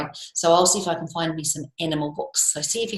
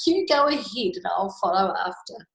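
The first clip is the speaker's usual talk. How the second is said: ordinary delivery, nothing marked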